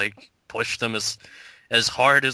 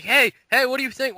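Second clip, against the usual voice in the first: high, louder mock reporter chirp; pushy reporter impression; 12 semitones higher, 4 dB louder than usual. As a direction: play an overeager street reporter shoving a mic.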